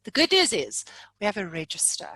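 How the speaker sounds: ordinary delivery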